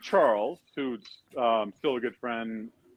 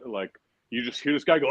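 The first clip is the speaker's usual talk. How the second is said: ordinary talk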